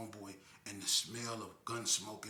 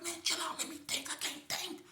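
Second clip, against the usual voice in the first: much higher strained voice; stressed snapping; 4 dB louder than usual. Retold or imitated. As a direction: snap back, overwhelmed. Calico overwhelmed, snapping back.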